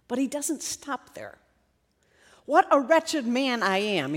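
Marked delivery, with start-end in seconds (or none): none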